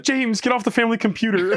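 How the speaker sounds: mock mom calling out, high and laughing; a parent's laughing mock scold; mock mom yelling. Mom yelling in retold bit.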